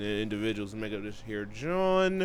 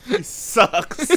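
ordinary talk

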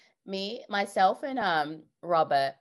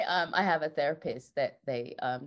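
normal talking voice

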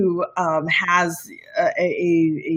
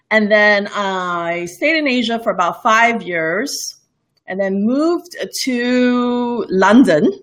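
ordinary talk, nothing marked

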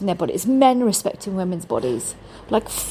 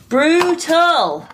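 Brutal! shouted louder and higher; emphatic repeat; 5 semitones higher, 5 dB louder than usual. Shown as a demonstration. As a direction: punch out Brutal!.

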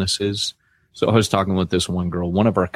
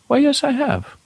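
matter-of-fact reply. Retold or imitated. Man answers hospital question.

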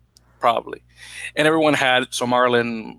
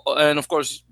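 ordinary delivery

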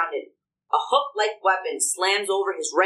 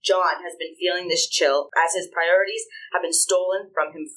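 normal talking voice

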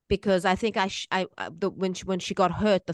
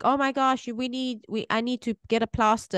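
mild alarm; 7 semitones higher than usual. Retold or imitated. Mother retelling alarm over nail.

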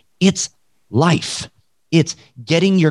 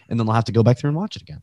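ordinary talk

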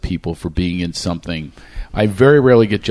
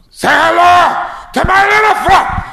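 high, loud, slow yelled Scarface line; full-throttle Scarface shout; wild Scarface impression; 6 dB louder than usual. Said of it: "I went crazy with this impression".

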